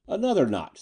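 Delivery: high-pitched order with a touch of grimness; mildly grim. A narrator's reading. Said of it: "said Eddie grimly".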